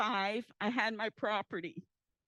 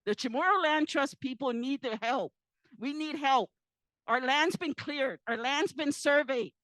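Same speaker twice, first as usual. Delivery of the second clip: louder, insistent, words pressed out one by one; real loud plea; 7 dB louder than usual. Real, not acted. Telling the panel her people need help, loud and insistent. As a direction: demand help from the panel, not backing down.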